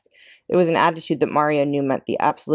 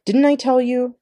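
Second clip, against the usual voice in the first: 1 angry Mama scolding; 7 semitones higher than usual. Acted. Mama scolds about the fire.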